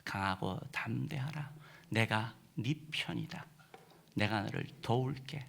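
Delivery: soft, slow, half-whispered comfort; tender reassurance. Acted. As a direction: whisper it like God comforting a child. God's tender reassurance to believers.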